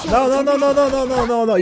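high, rapid string of no's; panicked. Real, not acted. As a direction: panic as you go down. About to die in game.

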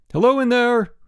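high, loud hail; shout. A narrator's reading. Bruno hailing whoever is in the basket, shouted. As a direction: call up loudly to someone. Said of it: "shouted Bruno".

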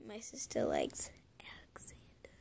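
soft, hushed secret; secret whisper. Real, not acted. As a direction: whisper it so nobody hears. Telling her sister's secret crush.

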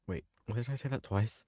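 ordinary delivery

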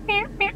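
high, soft squeaky twitches; imitated twitch noise. Vlogger imitating her eyebrow twitching under the device, high squeaks. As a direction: mimic a little twitch in two squeaks. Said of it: "your eyebrow is gonna be like".

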